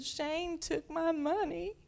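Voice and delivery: put-on high, slow whining little girl; whiny toddler. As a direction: complain like a crying two-year-old. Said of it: "And she said".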